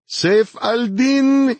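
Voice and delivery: very high, drawn-out hysterical cry. A narrator's reading. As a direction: wail it loud and high, stretching the last syllable. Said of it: "hysterical voice".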